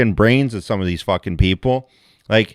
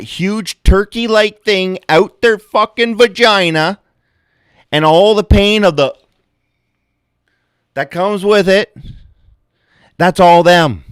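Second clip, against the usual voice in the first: higher and louder, building outrage; heated rant; 8 semitones higher, 5 dB louder than usual. Acted. Comedian ranting to audience about labor, higher and louder. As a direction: crank up the comic outrage. Comic rant on birth pain.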